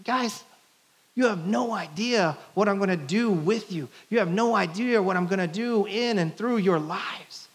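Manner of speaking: an excited promise to his friends, pitched high; excited Jesus. Pretend. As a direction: hype them up like a big brother. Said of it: "He's like".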